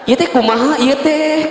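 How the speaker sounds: high, pointed demand; wife grilling husband over the contact; mock confrontation.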